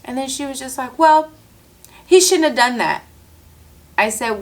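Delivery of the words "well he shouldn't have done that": mimicking coworker. Mimicking coworker's defensive answer.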